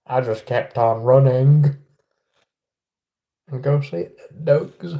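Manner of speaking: slow Southern drawl; slow, deliberate Southern US voice; Forrest Gump. Pretend. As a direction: drawl it like a simple Southern man. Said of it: "a bit like Forrest Gump".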